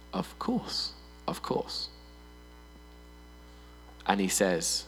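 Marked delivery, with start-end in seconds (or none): 0.1-1.9 hushed, unvoiced of course; quick whispered yes; whispered agreement. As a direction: whisper it meekly. Congregation's eager reply to God.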